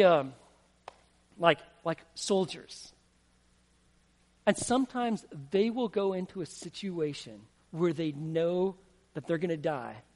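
normal talking voice

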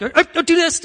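high, loud mock nagging; fussing parent. Overprotective parent correcting a boxed-in child, high loud fussing. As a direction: imitate a parent frantically micromanaging.

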